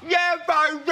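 put-on a high, loud shouted "Yeah, baby."; shouted catchphrase. Stranger shouting at the host at a petrol station, loud call. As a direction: yell it from a passing car. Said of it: "someone just drove their car straight by".

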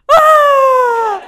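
high, loud cry of joy; delighted cry. Retold or imitated. Wife reacts to her husband's words with a loud happy cry. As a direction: burst out with a happy gasping shout. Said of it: "she goes".

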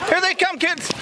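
very high bleating goat call; high singsong animal-herding calls mixed with mock bleats. Real, not acted. Calling goats with bleats.

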